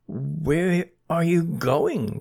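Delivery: drawn out, deliberate repeat; slow repeated question. Acted. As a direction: stretch each word slowly. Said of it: "repeated Bernie".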